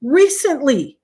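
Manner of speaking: emphatic insistence. Real, not acted. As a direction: hammer it home to a doubting listener. Insists to incredulous co-host.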